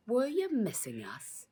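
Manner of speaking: high, slow mocking question; villain taunt. Policeman taunting the trapped children, mocking. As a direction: sneer it with menace.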